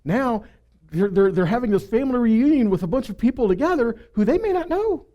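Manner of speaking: normal talking voice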